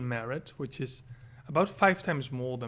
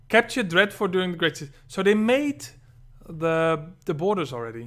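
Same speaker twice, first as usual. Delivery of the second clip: louder, exasperated rereading; frustrated; 5 semitones higher, 11 dB louder than usual. Real, not acted. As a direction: spit it out in annoyance. Rereads the name, annoyed.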